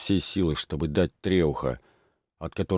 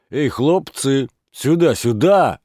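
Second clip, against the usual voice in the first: high, loud, drawn-out call; slowly yelling for the boys to come; 7 semitones higher, 7 dB louder, slower than usual. A narrator's reading. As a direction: summon the lads from across the street. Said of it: "кричал он".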